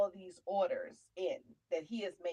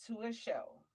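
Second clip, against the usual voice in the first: ordinary delivery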